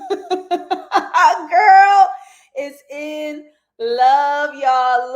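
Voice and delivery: high, loud, laughing and drawn out; squealing laugh. Real, not acted. Host squealing to viewers that the character is in love, laughing. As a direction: squeal it through giggles, stretching love.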